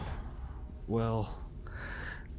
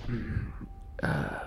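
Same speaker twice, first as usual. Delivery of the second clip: groggy voice; 5 semitones higher, much slower than usual. Acted. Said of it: "He has, like, a really groggy voice, like he was sleeping".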